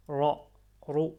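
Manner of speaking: loud emphatic r in Ra and Ru; full-mouthed Ra, Ru. Shown as a demonstration. Teacher explaining an emphatic letter, Ra and Ru said full-mouthed. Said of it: "avec une bouche pleine".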